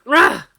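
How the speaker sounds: high, loud snarling roar; beastly roar. Acted. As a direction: roar like a cornered animal. Baring teeth.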